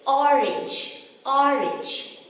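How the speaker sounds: "orange" opening on short aw; short O pronunciation. Shown as a demonstration. Teacher modelling the word for children, short-O orange. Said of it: "we have to say".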